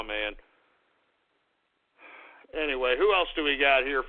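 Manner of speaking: ordinary talk, nothing marked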